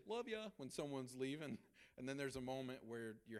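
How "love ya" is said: casual love ya. Shown as a demonstration. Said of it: "there's a time for you to say".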